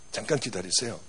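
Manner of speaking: a hint of a boy's low, soft voice; boy quietly telling the pastor to wait. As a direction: say it low and soft, calmly in control. Boy calmly stops the gang.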